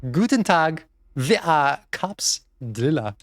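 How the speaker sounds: hammed-up German accent, drawn out; slow, mock-German band intro. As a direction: play a southerner faking a German accent. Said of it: "trying to do a German accent".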